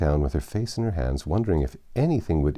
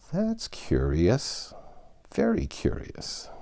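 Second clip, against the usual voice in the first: thoughtful; 8 semitones higher, 4 dB quieter, slower than usual. Acted. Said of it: "repeated thoughtfully".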